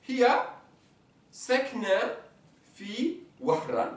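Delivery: Hiya sakna fi Wahran spaced, fi Wahran slowed; slow dictation. Shown as a demonstration. Teacher giving the full sentence slowly.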